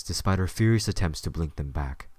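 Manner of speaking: normal talking voice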